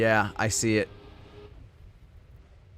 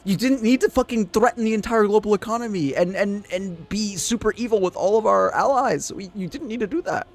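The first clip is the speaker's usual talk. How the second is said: exasperated rant; 8 semitones higher, 4 dB louder than usual. Real, not acted.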